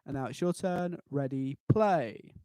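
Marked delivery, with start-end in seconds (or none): 1.1-2.3 drawn-out cue to start; slow count-in. Shown as a demonstration. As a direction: stretch out ready, then play. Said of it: "count-in cue".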